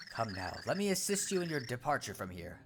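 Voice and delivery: soft, measured sage voice; calm wise pixel. Acted. Pixel answering the startled Cragnon, calm sage voice. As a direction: speak like a serene little oracle.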